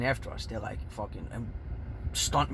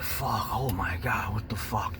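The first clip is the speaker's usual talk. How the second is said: whispery, drawn-out cursing; hushed dismay; 4 semitones lower, slower than usual. Retold or imitated. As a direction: breathe out the curse in shock. Retold dismay at injuries.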